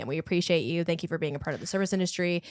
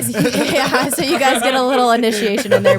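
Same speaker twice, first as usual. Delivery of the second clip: laughing; 4 semitones higher, 9 dB louder than usual. Real, not acted. Joking, laughing.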